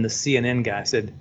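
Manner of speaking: ordinary talk, nothing marked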